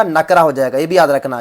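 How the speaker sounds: usual speaking voice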